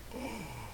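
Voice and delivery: sharp intake of breath, very soft; a whole room gasping in shock; imitating a crowd's sharp gasp; mock shocked gasp. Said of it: "deep inhalation of breath".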